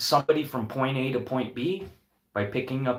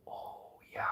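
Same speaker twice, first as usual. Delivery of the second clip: an unvoiced echo, to himself; whisper; 14 dB quieter than usual. Real, not acted. A man echoes his own words in a whisper. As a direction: breathe it out as a whisper.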